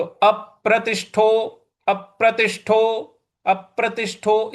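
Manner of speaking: अप्रतिष्ठो with प and ष struck; stress on p and sh. Shown as a demonstration. Teacher repeating अप्रतिष्ठो with firm stress. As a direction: press both प and ष in अप्रतिष्ठो. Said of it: "उस पर भी हमको बल लगाना है".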